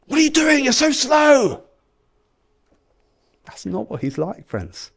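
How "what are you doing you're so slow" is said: high, louder impatient yell; mock yelling. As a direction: snap at them like an impatient father. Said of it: "our Father in heaven is yelling at us".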